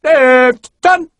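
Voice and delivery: loud, high barked drill command; corporal's shout. Acted. Corporal shouting a drill order at new recruits, loud. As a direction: bark the order across the parade ground. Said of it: "a corporal shouted".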